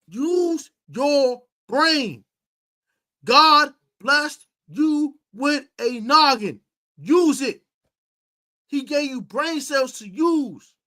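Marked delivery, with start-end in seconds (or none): 0.1-7.6 very slow, spaced-out words; slow lecture. Real, not acted. Host lecturing a player, very slowly. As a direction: drag each word out to make the point.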